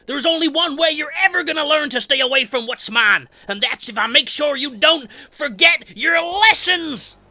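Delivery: high, loud character rant; Hitchhiker's angry threat. Acted. Hitchhiker threatens Leatherface over his comic book, yelled high and angry. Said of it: "he uses this as an excuse to start another fight".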